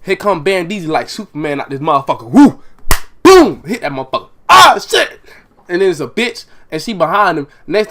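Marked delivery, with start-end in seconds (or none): 2.3-3.6 loud whoop then a high booming punch noise; punch sound effect. Retold or imitated. Acting out the punch. 4.5-5.2 excited yell. Retold or imitated. Reacting to the hit.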